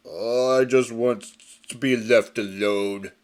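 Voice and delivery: a loner grumbling to be left be; sullen brute. Acted. Pig Iron replies.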